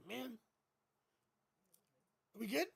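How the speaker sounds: ordinary delivery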